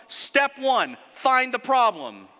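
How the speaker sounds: usual speaking voice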